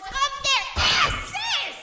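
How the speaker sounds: slow, loud, drawn-out yell; dramatic shout. Acted. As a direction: bellow it for the big reveal. Dramatic storytelling climax.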